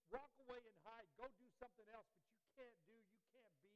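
loud, high, accusing voice; a loud scolding voice of the world. Acted. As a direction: hurl every failure at the listener. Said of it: "the world would look at you and say".

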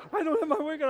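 hammed-up high shriek about the wig; shrieking in alarm. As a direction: mimic her panicked shriek over the missing wig. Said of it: "And she goes".

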